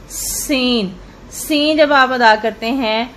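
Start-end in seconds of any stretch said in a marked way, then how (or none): 0.1-0.9 سین said thin with a whistling s; whistled thin seen. Shown as a demonstration. Teacher shows students the whistled سین. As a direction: hiss the س of سین thin and light. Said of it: "سٹی کی آواز کے ساتھ اور باریک".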